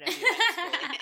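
high, loud laughing question; laughing disbelief. Retold or imitated. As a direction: laugh through the words in disbelief. Imagined reaction, laughing.

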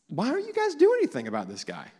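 high, naive puzzled question; puzzled David. Retold or imitated. David questioning the soldiers, high and puzzled. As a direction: ask as if it's obvious.

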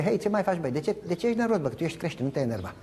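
a lightly voiced dog calming its owner; talking dog. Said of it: "Ar începe".

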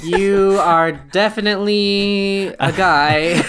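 drawn-out deadpan tease; slow teasing. Pretend. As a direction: stretch it out slyly. Teasing dry remark.

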